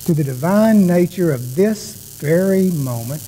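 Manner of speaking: calm, slow, soft prayer voice; guided meditation. Acted. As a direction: guide them gently into stillness. Leads the opening prayer meditation.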